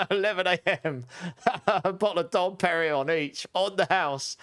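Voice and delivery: high-pitched, laughing disbelief; talking through laughter. Real, not acted. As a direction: crack up while recounting it. Laughing at own champagne story.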